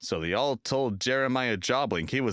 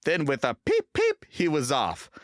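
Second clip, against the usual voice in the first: ordinary delivery, nothing marked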